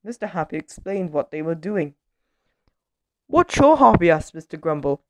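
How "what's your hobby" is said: grumbler's loud question. A narrator's reading. Grumbler quizzes the racing driver.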